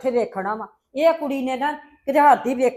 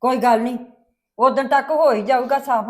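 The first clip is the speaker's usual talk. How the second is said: normal talking voice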